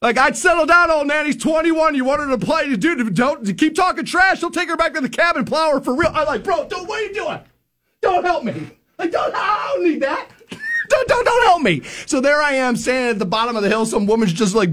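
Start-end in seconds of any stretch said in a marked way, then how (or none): 0.2-6.1 high, cocky taunting buddy; a reckless friend taunting an angry husband; trash talk. Retold or imitated. 6.4-11.8 high, laughing protest to a friend; begging a friend to stop making things worse; laughing plea. Retold or imitated.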